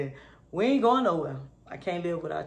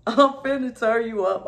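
higher, louder hype with a laugh; hype shout; 6 semitones higher, 10 dB louder than usual. Acted. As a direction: pump them up, laughing. Hyping up a down friend.

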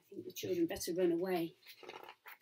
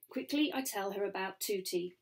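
ordinary delivery

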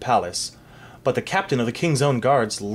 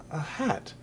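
much softer, stunned reveal; hushed discovery; 10 dB quieter than usual. A narrator's reading. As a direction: drop to near nothing in disbelief. Stunned discovery of another hat.

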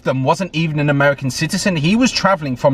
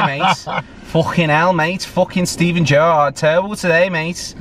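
Scouse accent; 4 semitones higher than usual. Shown as a demonstration. Fan slagging off Steven Gerrard's game, in a lightly done Scouse accent. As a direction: grumble like a Liverpool football fan, in Scouse. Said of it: "I might try and do it".

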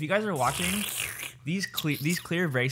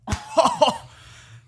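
loud laugh; 14 semitones higher, 8 dB louder than usual. Real, not acted. Laughs at the lisp.

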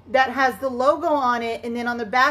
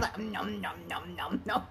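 mock chewing noises; 7 semitones lower, 13 dB quieter than usual. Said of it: "hearing people chew".

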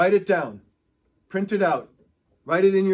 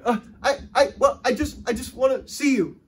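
high, loud, tripping over the words; breathless stumbling with a laugh; stuttering; 7 semitones higher, 4 dB louder than usual. Retold or imitated. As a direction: stammer it out with eager love. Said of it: "He's going".

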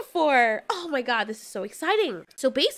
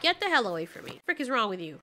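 ordinary talk, nothing marked

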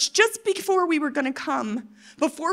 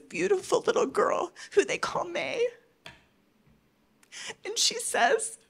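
voice breaking; 6 semitones higher than usual. Real, not acted. Tearful about little girl.

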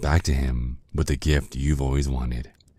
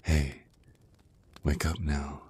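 softer whispered wake-up call; 4 dB quieter than usual. Acted. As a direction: coax them awake in a hush. Rousing the drained victim.

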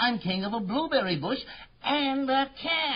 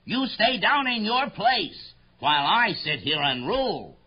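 boastful royal tirade; thundering royal voice; 8 semitones higher than usual. Acted. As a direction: lay down the law to a lowly subject. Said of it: "His royal voice thundered".

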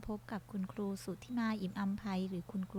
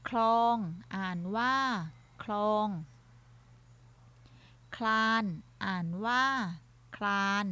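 louder, isolated words with long pauses between items; word-list reading drill; 5 dB louder than usual. Shown as a demonstration. Teacher leads pupils through a basic Thai word list, drill reading. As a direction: read out each word for pupils to repeat, loudly.

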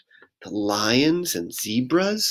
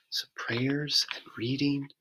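whisper; 10 dB quieter than usual. Real, not acted. Bedtime wind-down.

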